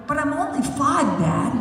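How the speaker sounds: a hint of a small boy whining; kid protesting. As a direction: play a little boy objecting. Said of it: "And Eric go".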